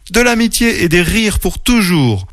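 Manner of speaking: high, happy rabbit voice; smiling. A narrator's reading. Mimi names the friends' wish, smiling. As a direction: answer high, with a big smile. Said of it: "répondit Mimi avec un grand sourire".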